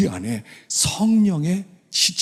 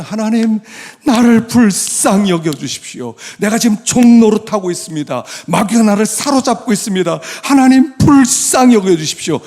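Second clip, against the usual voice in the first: loud, high pleading to God; urgent full-voiced supplication; fervent prayer; 8 semitones higher, 9 dB louder than usual. Shown as a demonstration. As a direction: cry out for mercy in prayer. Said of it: "그렇게 기도하면".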